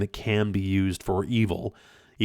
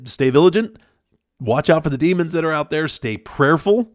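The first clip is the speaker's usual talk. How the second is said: ordinary delivery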